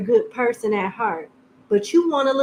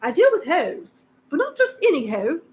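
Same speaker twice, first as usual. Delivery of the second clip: lightly voiced snooty accent; a bit of the uppity voice; put-on posh accent; 4 semitones higher than usual. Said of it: "I don't know where the accent come from".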